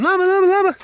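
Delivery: high, loud re-enacted call; retold yelling. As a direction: call the pet frantically, as a re-enactment. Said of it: "and I'm yelling".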